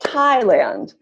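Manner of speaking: "Thailand" with THAI strong and long; stress on first syllable. Shown as a demonstration. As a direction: model "Thailand" as THAI-land. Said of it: "Answer is first syllable".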